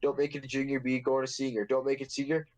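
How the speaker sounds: ordinary delivery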